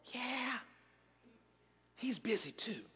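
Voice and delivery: put-on hushed child's whisper; whispering little boy. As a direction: whisper it like a small boy hiding. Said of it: "He was whispering".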